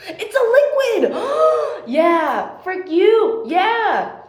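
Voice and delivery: loud, high victory yells; triumphant shouting. Real, not acted. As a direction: cheer like you won. Triumphant shouting after winning argument.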